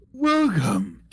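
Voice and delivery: high creature greeting; friendly right head. Pretend. Right head greets the adventurer, high friendly creature voice. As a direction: welcome them in a monster voice. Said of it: "the right head goes".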